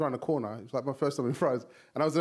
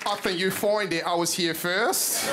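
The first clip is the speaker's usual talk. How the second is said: slow, drawn-out mock Australian; Aussie accent comeback; slower than usual.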